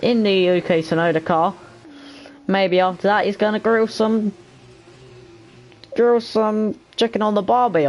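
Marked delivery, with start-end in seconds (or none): 2.5-7.9 mock Aussie accent. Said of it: "the Aussie accent".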